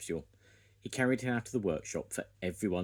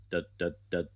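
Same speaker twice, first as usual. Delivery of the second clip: chanted drill sound; drill noise. Acted. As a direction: mimic a pneumatic drill. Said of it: "went the drill".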